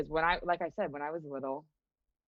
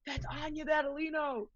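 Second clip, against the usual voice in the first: high, imitated fan gushing; mock starstruck; 10 semitones higher than usual. Townsfolk spotting their local star, mock starstruck. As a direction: gush like a hammed-up admirer. Acts out adoring town fans.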